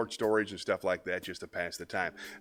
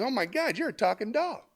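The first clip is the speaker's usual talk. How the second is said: higher, wide-eyed mock amazement; amazed; 14 semitones higher, 3 dB louder than usual. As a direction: gasp it out like you can't believe it. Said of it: "He says".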